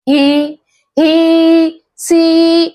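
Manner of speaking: ही and सी with the ee held long; long ee sound. Shown as a demonstration. Teacher drilling long ई, ही and सी drawn out. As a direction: sustain the vowel in ही and सी. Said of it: "बड़ी ई का".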